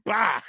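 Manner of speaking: high, sharp mock gunshot. Girl dressed as doctor fires at Michael, high mock shot. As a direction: blurt out a silly gun noise. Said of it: "and she went".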